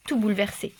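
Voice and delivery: plain reading voice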